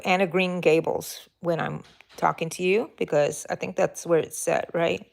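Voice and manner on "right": normal talking voice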